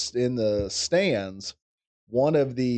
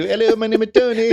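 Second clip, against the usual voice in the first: much higher, louder mock little boy voice; kid on phone; 13 semitones higher, 10 dB louder than usual. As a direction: squeak it like a nervous twelve-year-old. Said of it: "Thanks for calling Love Shack".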